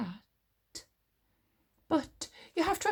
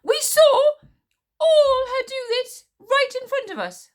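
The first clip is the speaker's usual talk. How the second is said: much higher and louder, fast frantic babble; babbling; 6 dB louder than usual. A narrator's reading. Witch smeller frantically reenacting the explosion, babbling. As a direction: babble it out, arms waving. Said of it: "babbled the witch smeller".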